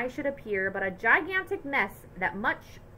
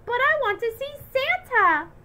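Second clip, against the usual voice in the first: put-on much higher, louder kid's whine; whining child; 8 dB louder than usual. A narrator's reading. Little brother whining to see Santa, high and loud. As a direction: whine like a small boy begging. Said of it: "Patrick said with a whine".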